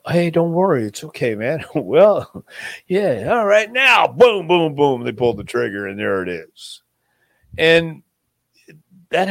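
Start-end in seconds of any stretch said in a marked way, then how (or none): 0.1-1.6 mock reassurance. Killer's fake reassurance before shooting. 1.7-4.1 high, loud, hammed-up thug voice; mock gangster, louder and higher; mock menacing call. Killer's line before shooting. 4.1-5.0 loud mock gunfire booms; three loud imitated gunshots; gunshot noises.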